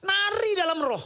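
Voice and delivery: high, loud cry; loud astonished exclamation; exclaimed. Retold or imitated. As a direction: burst out with it. Exclaiming wife danced in Spirit.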